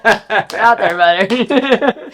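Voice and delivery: can barely get it out for laughing; cracking up while quoting his reply to haters. Real, not acted. Laughing while retelling hater replies.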